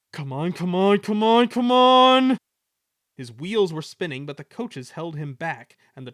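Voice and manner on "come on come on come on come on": frantic, building to a high shout; desperate engine at full effort; puffing. A narrator's reading. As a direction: strain harder with every repeat. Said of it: "he puffed".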